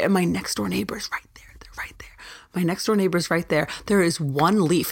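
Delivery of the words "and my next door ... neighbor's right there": hushed whisper so the neighbour can't hear; conspiratorial whispering aside. Real, not acted. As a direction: drop to a secret whisper. Neighbour is close by, hushing.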